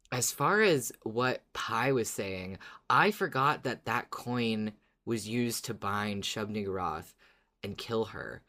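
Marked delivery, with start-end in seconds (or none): none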